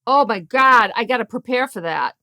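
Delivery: broad, loud Boston accent. Shown as a demonstration. Cohost asked to share her phenomenal accent, Boston accent. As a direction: lay on the Boston, dropping the R in hard. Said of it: "share your phenomenal Boston accent".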